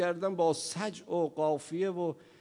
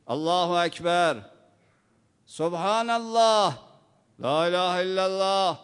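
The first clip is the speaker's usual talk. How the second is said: loud, higher, slow calls of the dhikr; worshippers calling; 4 semitones higher, 6 dB louder than usual. Acted. Worshippers calling out dhikr to stop Majnun, loud slow calls. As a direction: call out the prayer phrases to warn him.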